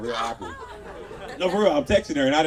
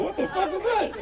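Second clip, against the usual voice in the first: shocked. Acted. Acted shocked shopper.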